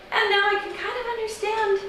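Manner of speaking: very high, fast, strained politeness; high-pitched, rushed exasperation; frustrated. Acted. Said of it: "the frustration one feels".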